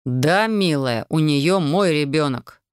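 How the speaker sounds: slow, weighted words; slow, deliberate and pointed; meaningful emphasis. A narrator's reading. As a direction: lean on each word slowly. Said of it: "повторяю со значением".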